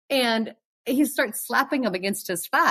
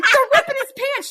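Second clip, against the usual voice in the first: very high, louder exclamation; incredulous; 5 dB louder than usual. Retold or imitated. To Rebecca in the van, very high exasperation. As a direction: stress how obvious it was. Friend never saw the rip.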